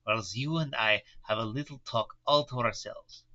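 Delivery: put-on Van Helsing in a German accent; old professor, German accent, gentle and kind. Professor comforts the pale young patient, German accent. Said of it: "you need a dialect coach".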